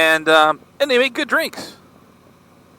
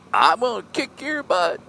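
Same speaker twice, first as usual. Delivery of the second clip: high, mock-belligerent threat; hammed-up tough talk from a drunk; drunk's threat; 10 semitones higher than usual. Drunk guy threatening a huge man, mock belligerent.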